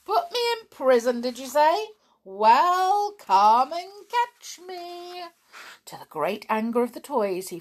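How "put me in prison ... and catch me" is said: high, louder sly mocking tone; taunting. A narrator's reading. Gnome taunts the toys from his aeroplane, mocking. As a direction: dare them to catch you, sneering.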